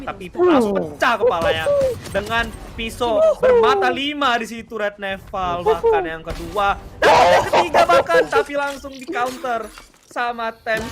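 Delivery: loud hype call with a laugh; excited shouting. Real, not acted. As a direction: yell the multi-kill play. Multi-kill play.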